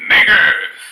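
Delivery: loud yelled provocation; mock yell. Man barging into a chicken shop, yelling. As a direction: hurl it across the room.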